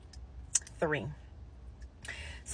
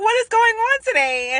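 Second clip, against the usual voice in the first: high, loud, astonished cry of disbelief; stunned; 8 dB louder than usual. Retold or imitated. Nurse shocked her silent patient is suddenly talking, loud amazed cry. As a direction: blurt it out in total shock, pitched way up.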